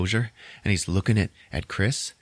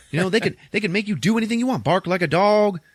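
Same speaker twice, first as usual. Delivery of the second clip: high-pitched comic rant; alarmed warning; 11 semitones higher, 4 dB louder than usual. Retold or imitated. Rod warns Chris about hypnotists, worked up. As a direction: act out a worked-up friend warning his buddy.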